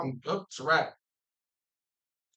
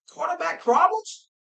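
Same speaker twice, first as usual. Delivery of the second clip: high, mocking disbelief; incredulous; 7 semitones higher than usual. Real, not acted. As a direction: scoff that anyone could doubt it.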